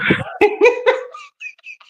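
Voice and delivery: sudden high-pitched laugh; high burst of laughter. Real, not acted. Laughing after nearly falling.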